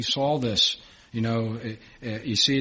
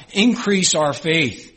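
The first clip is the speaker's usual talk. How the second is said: loud, slow, raised plea; crying out; 4 semitones higher, 6 dB louder, slower than usual. Acted. Apostles cry out to Jesus, loud slow plea. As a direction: cry it out to the Lord. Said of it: "cry out".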